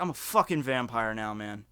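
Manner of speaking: lightly voiced friend stating it without emotion; deadpan. Friend calmly tells the narrator he is a vampire, deadpan. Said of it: "'cause he said it deadpan".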